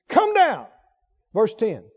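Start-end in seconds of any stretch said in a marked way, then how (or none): 0.1-0.7 high-pitched disrespectful summons; sarcastic. Shown as a demonstration. Captain ordering the prophet off the hill, sarcastic. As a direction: call him down in a mocking tone.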